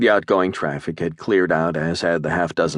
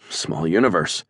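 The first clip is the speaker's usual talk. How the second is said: ordinary delivery, nothing marked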